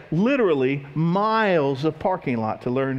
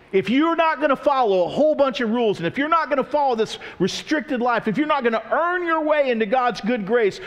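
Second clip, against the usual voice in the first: higher, forceful mock scolding; insistent legalist; 8 semitones higher than usual. Enemies of the cross demanding rules, insistent scolding. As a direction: imitate a demanding rule-keeper laying down terms.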